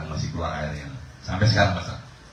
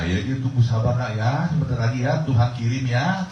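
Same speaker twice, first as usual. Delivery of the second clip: higher, gentle coaxing father; father soothing child; 7 semitones higher, 4 dB louder than usual. Acted. Father reassures his hungry son that God will send food, coaxing.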